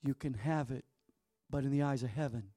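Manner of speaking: usual speaking voice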